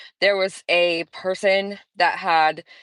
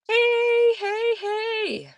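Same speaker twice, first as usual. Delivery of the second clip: sing-song greeting; much slower than usual. Real, not acted. Greeting chat viewers.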